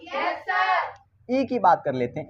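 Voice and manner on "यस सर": high, lightly voiced pupils chorusing; pupils answering. Teacher voices his class answering him, high pupil reply. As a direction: pipe up like obedient schoolkids. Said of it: "अरे हाँ ना बोलो".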